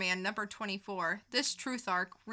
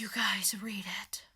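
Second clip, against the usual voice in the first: whisper. Acted. Whispered follow-up tease.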